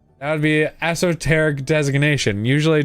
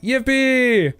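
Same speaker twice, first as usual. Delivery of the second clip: excited yippee; 10 semitones higher than usual. Real, not acted. Celebrating a success.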